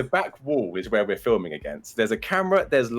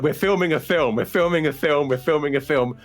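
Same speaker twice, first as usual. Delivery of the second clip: high, urgent repeated plea; retold desperate backpedalling, pitched up; frantic explaining; 6 semitones higher, 4 dB louder than usual. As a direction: blurt it out in mock panic.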